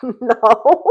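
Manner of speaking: loud no breaking into high laughter; laughing no. Retold or imitated. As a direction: laugh the refusal out. Refusing him, laughing.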